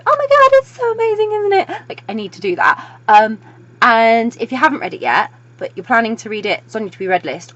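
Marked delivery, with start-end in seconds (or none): 0.1-1.8 very high, loud gushing; high-pitched excited outburst; fangirl squeal. Retold or imitated. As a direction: squeal it to a fellow fan. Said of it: "literally just to go".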